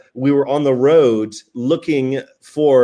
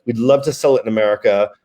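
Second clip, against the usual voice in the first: usual speaking voice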